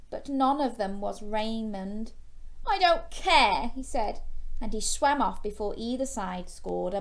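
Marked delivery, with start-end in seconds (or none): 2.7-3.7 high, loud sulky snap; young shark sulking at his mum, high and loud; sulky outburst. A narrator's reading. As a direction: pout out I don't care. Sulking Gilbert.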